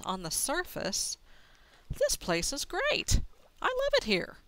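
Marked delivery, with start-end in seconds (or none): 1.9-4.4 thrilled, high and loud; gushing with delight; excited. Real, not acted. Delighted by treasure.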